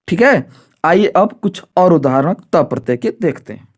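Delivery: ordinary talk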